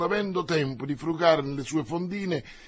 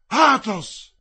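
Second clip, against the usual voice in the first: higher, louder cry of the name; startled recognition; 7 semitones higher, 8 dB louder than usual. Acted. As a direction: cry out in shock at a friend's face. Recognises his friend in flash.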